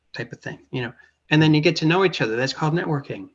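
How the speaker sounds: ordinary delivery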